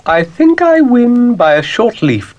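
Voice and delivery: high, grand lady-cow voice; a fussy, genteel cow poking in; Ermyntrude the cow. Acted. Ermyntrude wins the contest.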